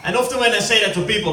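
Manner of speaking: normal talking voice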